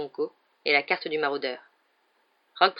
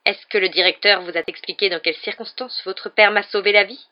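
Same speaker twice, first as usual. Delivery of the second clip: usual speaking voice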